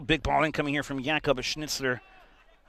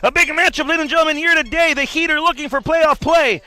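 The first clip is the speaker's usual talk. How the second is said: loud, high, fast sports hype; hyped commentator; 12 semitones higher, 9 dB louder than usual. Acted. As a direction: sell the big matchup.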